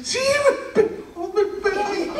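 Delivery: put-on very high, slow whiny child voice; little girl insisting. Daughter insists, crying. (niña insistiendo con voz muy aguda y lenta)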